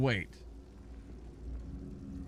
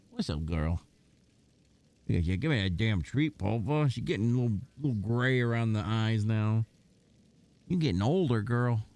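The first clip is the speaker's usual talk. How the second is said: talking to the dog; 5 semitones lower than usual. Real, not acted. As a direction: coo at her gently. Talking fondly to his dog.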